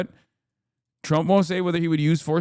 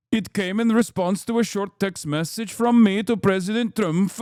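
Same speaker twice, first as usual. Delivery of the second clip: put-on Norwegian accent, measured pace; hammed-up Norwegian accent for an official statement. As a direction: read it as a Norwegian prime minister's statement in a Norwegian accent. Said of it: "that's a perfect Norwegian accent".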